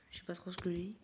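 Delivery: under your breath, half to yourself; self-conscious whispered aside. Real, not acted. Muttered aside about own slip.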